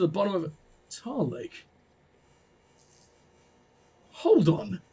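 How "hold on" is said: ordinary talk, nothing marked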